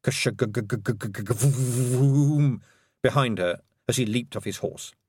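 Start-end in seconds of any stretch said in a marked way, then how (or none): 0.0-2.6 sputtering engine vroom. A narrator's reading. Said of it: "a faint".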